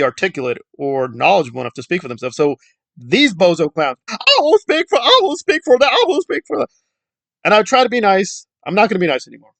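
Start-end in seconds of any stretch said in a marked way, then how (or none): 4.1-6.7 higher, self-important mock declaration; mock pompous voice. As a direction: parody the bozo clowns. Mocking self-appointed spokespeople.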